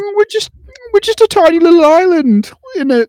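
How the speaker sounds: very high, loud mock-humble British voice; mock posh British. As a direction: simper in a falsetto British modesty. Mocking British modesty.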